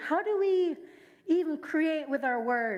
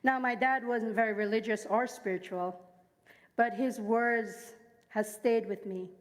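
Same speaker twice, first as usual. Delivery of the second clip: ordinary talk, nothing marked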